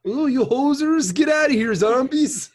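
high, loud mock-Canadian alarm; hammed-up hoser raising the alarm; broad joke Canadian panic. Mock Canadians fleeing zombies.

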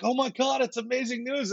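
high-pitched imitation of a thrilled friend; excited outburst pitched well up; excited congratulation. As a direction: gush over big news, retold. Friend's reaction to overheard good news.

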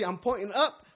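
ordinary delivery

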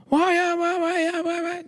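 very high, screeched babble of a screaming woman; mock screaming. Dumpling lady as talk show guest, screaming shrilly. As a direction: shriek nonsense syllables at the top of your range. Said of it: "she screams a lot".

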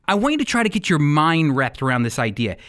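ordinary talk, nothing marked

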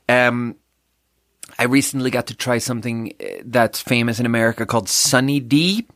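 normal talking voice

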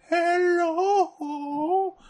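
high, strained goofy hello; whiny unhinged comic voice; crazy voice. Retold or imitated. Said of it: "Why do you do that voice?".

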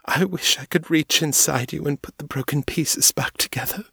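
breathy whisper through tears; whispered grieving wish; tearful whisper. A narrator's reading. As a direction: whisper it, barely holding on.

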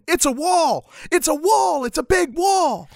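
excited discovery. Acted. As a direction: announce the big discovery, thrilled. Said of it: "Tech walks up to the horizon line".

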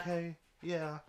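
put-on high, breathy girl's voice; breathy Hazel. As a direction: imitate a narrator's breathy character voice. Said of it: "Like, her breathy".

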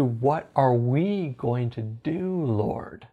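slow, low, hushed question to God; shifted, trusting attitude. Retold or imitated. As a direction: ask it softly, settled and unhurried. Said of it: "shifted his attitude from".

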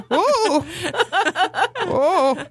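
put-on high startled old-lady cry; lightly voiced old lady. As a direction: mimic an old woman knocked off balance. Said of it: "An old lady".

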